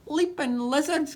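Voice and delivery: surprised pirate oath; gasped. A narrator's reading. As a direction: gasp it out in amazement.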